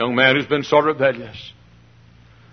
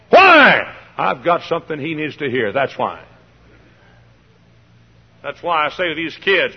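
ordinary delivery

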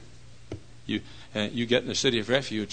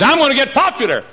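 high, loud mock declaration; would-be popular person; 12 dB louder than usual. Unhappy person chasing popularity, high loud imitation. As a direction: mimic an eager seeker announcing a plan.

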